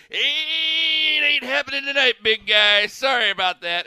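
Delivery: high, loud mock wife voice; imitating wife. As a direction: play the wife turning him down, loud and high. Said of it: "it's like she talked like how".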